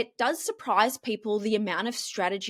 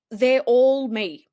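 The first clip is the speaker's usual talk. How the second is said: slow, loud, drawn-out "all me"; a slow, emphatic claim that every voice is hers; stress on all; 2 semitones higher, 6 dB louder, much slower than usual. Real, not acted. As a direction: lean hard on "all", slowly. Emphatic point on her voice.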